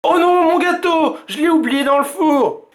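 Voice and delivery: cri aigu et fort de dépit; papa qui se souvient de son gâteau; papa dépité. A narrator's reading. Papa remembers cake. (papa dépité, cri aigu)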